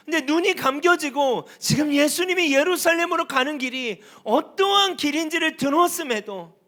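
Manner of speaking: high, loud sermon delivery; forceful preaching. Real, not acted. Preacher confronts congregation with disciples' hidden motives, forceful preaching. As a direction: drive each phrase at the congregation.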